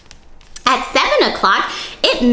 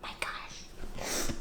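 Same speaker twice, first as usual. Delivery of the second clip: whisper; 22 dB quieter than usual. Real, not acted. Reader's awed aside to children.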